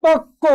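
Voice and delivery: পক্ক said as pok-ko, high, with a doubled k; doubled consonant. Shown as a demonstration. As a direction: hold the k in পক্ক before releasing it. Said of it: "দ্বিত্ব হবে। যেমন ধরো".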